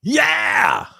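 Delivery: sudden high yelled yeah; excited shout. Real, not acted. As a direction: blurt it out loud and high. Excited reaction to chat comment.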